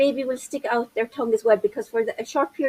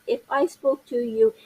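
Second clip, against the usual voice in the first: droning, even tone held all through; monotone demonstration; 2 semitones lower than usual. Shown as a demonstration. Presenter shows parents what tone of voice is, in a monotone. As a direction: speak in a dull, unvarying tone. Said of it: "in this tone of voice".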